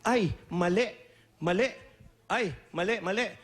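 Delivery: short, repeated corrections, each lifting at the end; imitated overheard voice. Retelling the voice heard in the video catching a mistake, lightly imitated. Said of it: "ang maririnig niyong boses dun sa YouTube".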